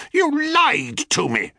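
high furious shriek. A narrator's reading. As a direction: shriek it at the liar. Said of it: "she shrieked".

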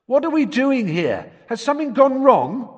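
put-on puzzled young voice; confused boy voice. Ron lost in the fireplace.

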